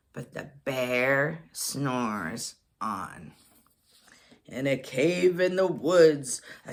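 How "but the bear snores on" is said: slow, low, sleepy refrain. A narrator's reading. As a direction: draw it out softly like a lullaby.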